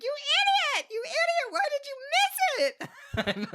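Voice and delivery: very high-pitched, hammed-up self-scolding; hysterical breakdown. Retelling missing the exit at the wheel, mock hysterical. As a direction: squeal at yourself in mock hysterics. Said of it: "hysterically break down again".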